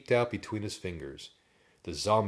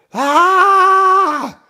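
high, loud drawn-out scream; terrified long "ah"; 20 dB louder than usual. A narrator's reading. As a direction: scream at a hideous sight. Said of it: "Drew screamed".

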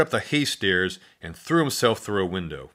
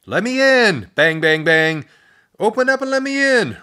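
loud, much higher shouting; desperate yelling at the door; panicked shout; 11 semitones higher, 8 dB louder than usual. A narrator's reading. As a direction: pound and yell for help. Pounding on door, bull coming.

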